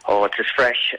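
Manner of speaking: normal talking voice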